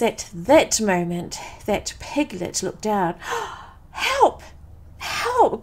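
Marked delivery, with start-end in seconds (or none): 3.5-5.6 very high-pitched storybook cry for help; frightened cry. A narrator's reading. Piglet on spotting the Heffalump, frightened cry. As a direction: squeal it as panicked little Piglet. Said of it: "cried Piglet".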